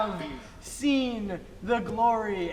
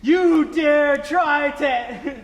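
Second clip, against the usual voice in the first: booming demon lord; 3 semitones higher, 6 dB louder, much slower than usual. Acted. Cultist priest threatens player.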